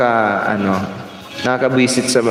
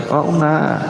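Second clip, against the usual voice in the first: ordinary talk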